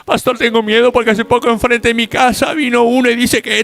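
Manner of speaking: scared churchgoer. Retold or imitated. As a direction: mimic a jittery member complaining to the pastor. Same frightened churchgoer again.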